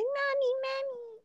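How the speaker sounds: put-on high, soft childish "mommy"; baby mommy voice. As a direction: call for mommy like a little kid. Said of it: "does a mommy voice".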